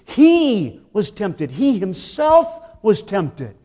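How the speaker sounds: slow, weighted "He was tempted", then "He himself was tempted"; emphatic. Shown as a demonstration. Preacher to congregation, slow emphatic repetition. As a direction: press each word down on "himself". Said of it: "say it with emphasis".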